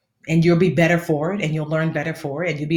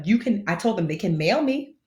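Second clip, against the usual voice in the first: ordinary talk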